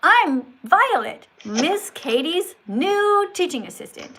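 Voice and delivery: "I'm Violet" slow, high and loud, "new" stretched out. Shown as a demonstration. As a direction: introduce yourself with big swoops on "Violet" and "new". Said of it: "a little bit more inflection on your voice".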